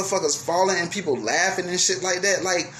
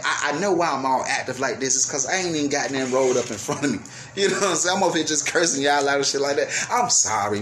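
ordinary delivery

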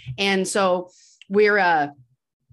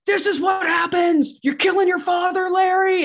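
unhinged yelling; 10 semitones higher than usual. Retold or imitated. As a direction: lose it completely, yelling. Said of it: "Walter loses his mind".

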